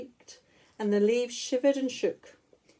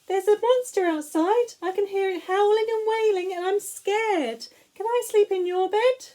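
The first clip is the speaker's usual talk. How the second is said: high, louder little cub voice; a frightened cub begging to come into the bed; small child pleading with fear; scared Baby Bear; 9 semitones higher, 4 dB louder than usual. Acted.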